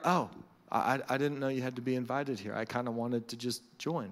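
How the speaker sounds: small, low, quick apology; sheepish newcomer. Acted. Newcomer intruding on a private club, sheepish and low. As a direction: shrink back, stammering softly.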